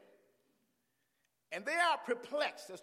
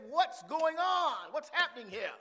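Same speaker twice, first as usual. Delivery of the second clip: crying out; 8 semitones higher, 6 dB louder than usual. Acted. Blind beggar asking the crowd, crying out. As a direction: call out for an answer. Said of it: "He, he cried out".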